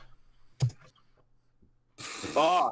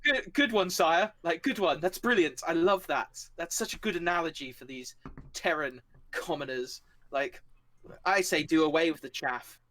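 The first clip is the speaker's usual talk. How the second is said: excited toady, pitched up at first; fawning young paladin; 3 semitones higher than usual. Acted. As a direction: gush over the sire's remark. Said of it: "He looks a bit like a monkey, and he's like".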